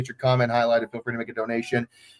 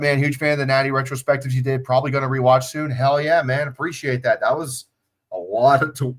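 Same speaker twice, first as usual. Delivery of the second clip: usual speaking voice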